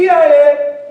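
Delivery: high, loud chant of E I A; call-out for the class to repeat. Shown as a demonstration. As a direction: call it out for them to echo. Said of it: "Okay, repeat after me".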